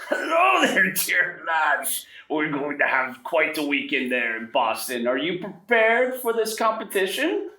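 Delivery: put-on very high, jolly old professor greeting the lads; Professor Dalen. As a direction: beam at the students like a grand old don. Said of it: "Professor Dalen looks at both of you and goes".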